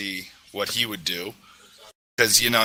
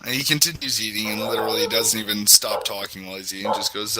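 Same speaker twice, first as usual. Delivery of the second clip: ordinary delivery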